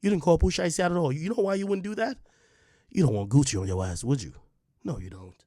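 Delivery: normal talking voice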